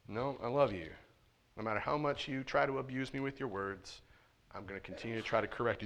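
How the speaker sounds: soft, steady, loving reply; quiet, calm fatherly voice; patient good father. Pretend. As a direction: stay gentle under the insults. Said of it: "A good father says".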